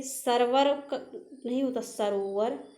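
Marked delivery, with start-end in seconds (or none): none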